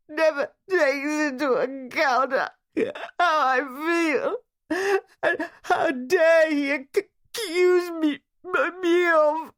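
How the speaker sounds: high, loud tearful outburst with catches; crying. A narrator's reading. Wife crying out against her husband to a friend. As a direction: break down while accusing him. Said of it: "her tears covered whatever it was".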